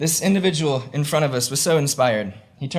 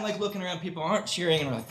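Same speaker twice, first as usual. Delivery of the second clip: ordinary delivery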